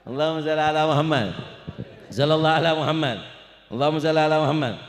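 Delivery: fast, loud call of blessing; loud salawat call. Real, not acted. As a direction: rouse the crowd with the salawat. Congregation salawat called loudly.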